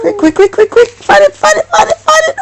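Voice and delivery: high rushed urging to find the page; excited hurry. Real, not acted. Mother playfully urging her child to find the lost page, high and excited. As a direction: chirp it fast, playfully urging.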